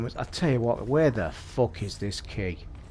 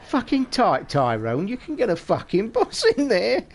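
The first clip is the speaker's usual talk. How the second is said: retold film shout; 8 semitones higher than usual. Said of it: "And he went".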